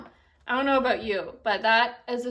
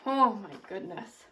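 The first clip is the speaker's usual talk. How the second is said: ordinary delivery, nothing marked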